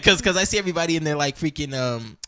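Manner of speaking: ordinary delivery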